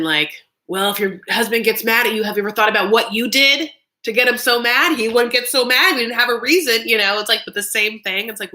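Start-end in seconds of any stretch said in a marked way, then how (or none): 0.7-6.9 higher, louder mock-scolding; old-school marriage advice. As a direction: lecture a wife like an old advice column. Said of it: "old school thing you would say".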